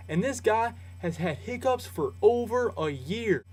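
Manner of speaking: hammed-up Southern accent, deliberately bad. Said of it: "That was a terrible Southern accent".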